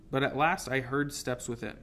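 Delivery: ordinary delivery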